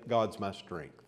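ordinary talk

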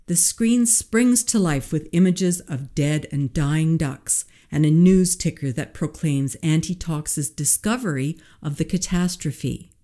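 ordinary delivery, nothing marked

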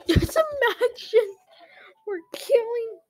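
high, loud and drawn out; slow, high squeal with laughter; laughing mock horror. Gamer joking to viewers, laughing and squealing.

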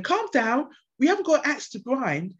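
high patronising imitation of a colleague; imitating a colleague telling her to calm down; mock calm-down. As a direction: raise the voice like someone brushing it off. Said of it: "somebody going".

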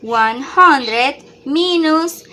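slow model answer. Shown as a demonstration. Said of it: "Aquí tenemos una resta".

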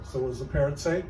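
normal talking voice

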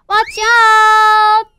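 loud, high, long held shout; sustained storytime cry of caution; warning shout. Acted. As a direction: holler a warning to the little fish. Children warn the little fish.

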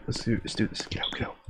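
hushed tense whisper. Real, not acted. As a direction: whisper it under your breath. Sneaking out, whispering.

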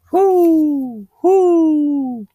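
high, loud falsetto hoots; two drawn-out owl calls; hooting owl. Acted. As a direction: hoot like an owl, long and falling. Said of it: "said the owl".

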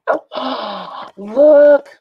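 loud high gasp of delight; gasp, then point it out thrilled; surprised gasp. Real, not acted. Gasps at cute picture.

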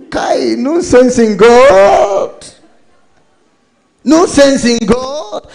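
high, loud, slow scoffing outcry; God scoffing. Acted. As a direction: scoff at the idea as an indignant God. God voiced rejecting the idea.

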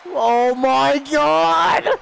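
very slow, loud, rising exclamation; shocked yell. Real, not acted. Gamer watching a huge wrestling move, shocked yell. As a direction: stretch every word out in shock.

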